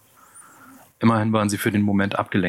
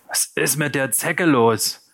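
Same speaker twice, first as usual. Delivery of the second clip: slow, higher questioning call; 6 semitones higher, slower than usual. A narrator's reading. Gang member asks about the limp victim, calling out. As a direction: call the question across the carriage. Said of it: "rief einer".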